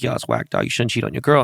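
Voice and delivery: ordinary talk, nothing marked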